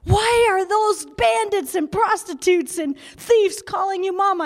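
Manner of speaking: exasperated officer, acted out; imitating angry police. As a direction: question her in mock outrage. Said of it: "they're really angry".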